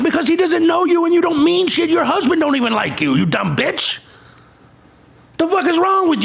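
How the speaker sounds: high, heated yell at an imagined fan; ranting at a true crime fan, high and heated; angry rant. Real, not acted.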